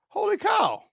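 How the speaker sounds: high, stunned holy cow; stunned at the body bag, pitched high; amazed. Retold or imitated. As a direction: let it jump way up in shock.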